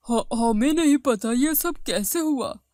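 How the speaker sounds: stammered, mock-tearful answer. A narrator's reading. Wife unable to explain her lost hair, tearful. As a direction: stumble into the words as if crying. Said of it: "रो मत".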